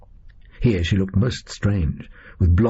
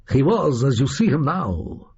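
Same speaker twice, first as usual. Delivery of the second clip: put-on higher-pitched, theatrical Dracula accent; extraordinary Dracula accent; 6 semitones higher than usual. Dracula answers his fellow captor, extraordinary accent. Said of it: "in an extraordinary accent".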